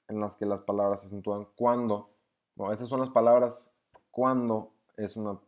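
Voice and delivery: normal talking voice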